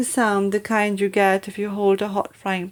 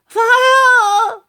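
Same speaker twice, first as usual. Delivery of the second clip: a much higher, louder shriek; yelled; 7 dB louder than usual. Acted. Grandma burning inside: high, loud yell of fire. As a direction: scream the alarm at full force. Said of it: "the old woman yelled".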